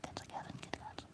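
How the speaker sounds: barely audible, voiceless whisper. Real, not acted. Showing off the cat, whispered. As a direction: hush right down to a whisper. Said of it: "Why is he whispering?".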